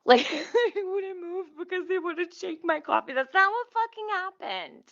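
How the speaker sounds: high, half laughing protest; laugh-crying outrage. Real, not acted. Complaining to viewers about the article, high and laughing. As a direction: disbelief breaking into laughter.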